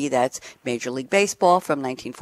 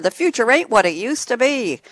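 light mock New York accent, pitched up; quasi-New York accent; 7 semitones higher than usual. Host quoting Yogi Berra to listeners, in a quasi-New York accent. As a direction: quote it in a half-hearted New York accent. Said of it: "the quasi-New York accent".

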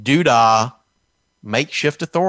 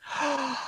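mock gasp; 13 semitones higher, 12 dB quieter than usual. Mock horror at passports.

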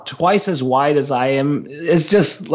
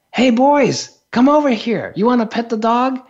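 put-on friendly call to kids; 8 semitones higher than usual. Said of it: "turns over to the kids and says".

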